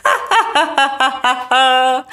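high staccato cackle; little devil's laugh. A narrator's reading. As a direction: laugh like a mischievous imp. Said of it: "засміявся чортик".

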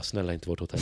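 soft hushed begging under the breath; quiet plea. Retold or imitated. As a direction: pray quietly to yourself. Begging the lads skip their hotel.

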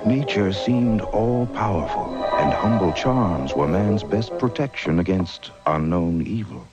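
ordinary delivery

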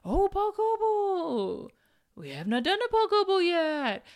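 high, slow, gushing fake enthusiasm; mock excited. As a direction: coo it out like a thrilled fan, high and drawn out. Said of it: "And I was like".

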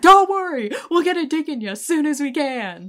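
high, loud holler; shouted mock reassurance. Quoting Mickey on his way out, shouted mock reassurance. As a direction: call it out as a parting jab. Quoting character calling out while leaving.

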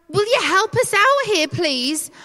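high-pitched, pleading mock panic; acted panic. Panicking disciples waking Jesus, exasperated plea. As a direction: plead urgently, pitched well up. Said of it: "the disciples are freaking out and they're panicking".

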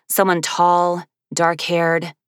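ordinary delivery

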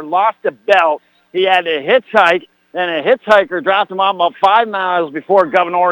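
ordinary talk

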